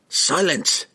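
sharp high command. A narrator's reading. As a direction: cut him off with authority. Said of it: "Dendibar commanded".

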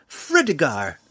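dwarf insisting on his full name, indignant; indignant insistence. A narrator's reading.

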